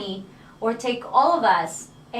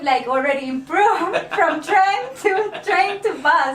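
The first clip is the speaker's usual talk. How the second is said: laughing; 7 semitones higher, 5 dB louder, slower than usual. Real, not acted. Joking about train to bus.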